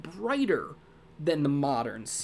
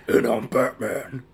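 low, slow gravelly Batman growl; low gravel vigilante growl; 5 semitones lower, 6 dB louder, slower than usual. Retold or imitated. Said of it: "put on a crazy gravelly voice for Batman".

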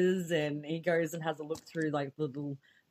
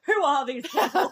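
higher, louder, laughing question; laughing disbelief; 7 semitones higher, 6 dB louder than usual. Real, not acted. Co-host asking about the film's random lovers, laughing disbelief. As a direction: ask it through real laughter. Laughing at unexplained film characters.

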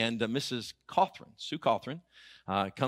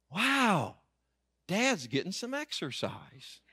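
mock-impressed, starting high on a wow; naive admiration; 5 semitones higher, slower than usual. Clueless son watching his dad trudge along, naively impressed. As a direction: marvel at it, blissfully clueless.

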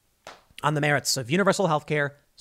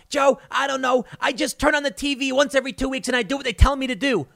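much higher, quick put-on dumb-guy voice; Bill Burr bit; 9 semitones higher, faster than usual. Comedian's dumb TV viewer telling Joe he obeys orders. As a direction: play it like a clueless guy proud of obeying the TV. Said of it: "He was like".

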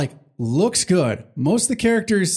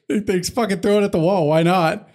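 normal talking voice

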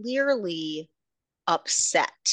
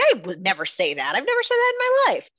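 indignant denial; 8 semitones higher, 4 dB louder, faster than usual. Retold or imitated. Kai denying the accusation.

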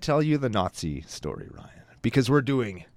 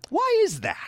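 whiny complaint; slower than usual. Real, not acted. Mock complaint about luck.

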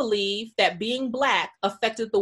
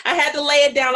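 laughing; 6 semitones higher, 7 dB louder, faster than usual. Real, not acted. Host talks through a laugh.

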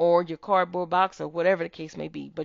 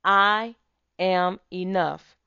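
louder, slow affirmation; booming motivational speech; 4 dB louder, slower than usual. Shown as a demonstration. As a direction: say it like you mean it. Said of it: "One more time".

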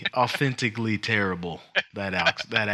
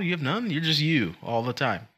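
normal talking voice

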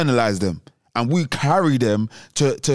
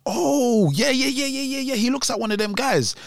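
high, eager yeah yeah yeah; mock excited agreement, higher; excited mate; 9 semitones higher than usual. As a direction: play the hyped friend, pitched up. Another mate hyped recognizing the kid.

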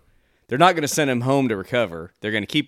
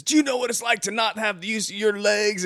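quoted line; 9 semitones higher than usual. Retold or imitated. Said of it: "how he says".

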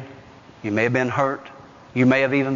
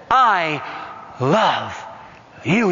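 tender, slow I love you; much slower than usual. Retold or imitated. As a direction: say it like a gentle whisper of comfort. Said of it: "the Holy Spirit says".

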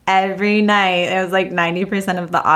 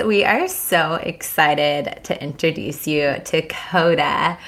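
ordinary talk, nothing marked

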